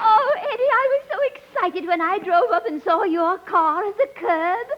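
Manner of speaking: a hint of a fluttery, giddy society lady; scatterbrained lady. Billy gushes to Eddie, lightly voiced giddy lady. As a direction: play it dizzy and delighted. Billie Burke's scatterbrained persona.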